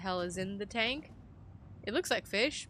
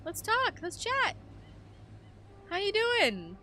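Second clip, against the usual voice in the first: much higher, sing-song chatty voice; overly cheerful greeting; 8 semitones higher than usual. Pretend. Player greeting the depressed biologist, chirpy and high. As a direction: coax him like a nervous host.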